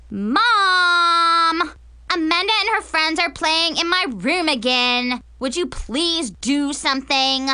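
whiny holler, first word drawn out; calling for Mom. Acted. Girl demands Mom deal with Amanda and her friends, whiny calling. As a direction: complain loudly to a parent in another room.

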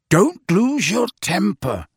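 high, firm warning; abrupt. A narrator's reading. Sirius warns Harry before the hearing, abrupt. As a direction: press the advice on him suddenly. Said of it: "said Sirius abruptly".

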